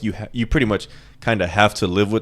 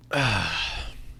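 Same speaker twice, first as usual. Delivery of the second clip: heavy sigh; 7 dB quieter than usual. Real, not acted. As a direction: let out a heavy breath. Exhales after intense family talk.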